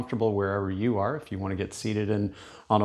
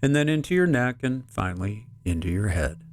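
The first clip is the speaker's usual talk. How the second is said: guided meditation. Real, not acted. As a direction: lead them gently through the body, phrase by phrase, unhurried.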